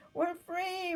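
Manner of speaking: high joyful cry of freedom; rejoicing. Acted. Freed prisoner rejoicing as chains break, overjoyed. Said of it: "rejoiced a prisoner".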